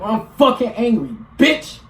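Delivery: mock anger jumping up on the last word; demonstrated angry outburst; angry demo. Shown as a demonstration. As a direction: act out a burst of anger, punching the final word. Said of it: "hear the fucking emotion in your voice".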